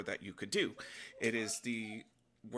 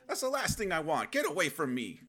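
higher and louder acted protest; mock alarmed rejection; 7 semitones higher, 5 dB louder than usual. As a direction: push them away in hammed-up alarm. Acts out rejecting someone's love.

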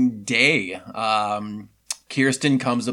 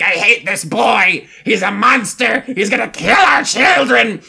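high, loud, panicked mock yell; hysterical shout; 8 semitones higher, 5 dB louder than usual. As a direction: shriek it like a frantic mother. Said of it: "Kirsten's like".

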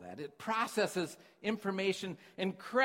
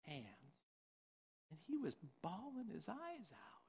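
normal talking voice

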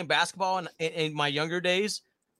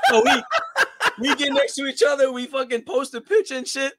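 higher and louder, laughing through words; chuckling while telling it; amused recollection of the photo; 8 semitones higher, 4 dB louder than usual. Real, not acted.

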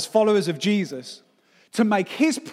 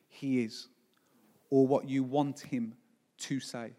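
softer, slow and lower; slow tender appeal; 4 semitones lower, 4 dB quieter, slower than usual. Acted. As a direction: let each phrase land gently.

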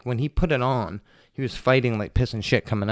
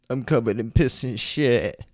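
quoting the mechanic. Retold or imitated. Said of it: "To quote him is".

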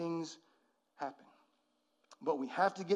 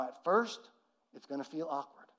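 usual speaking voice

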